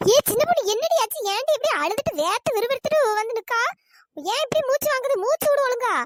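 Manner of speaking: high-pitched worried questioning; alarmed questioning. Acted. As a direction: fire alarmed questions at a crying girl. Alarmed at crying, panting girl. (பதற்றத்தோட விசாரிக்கிறது)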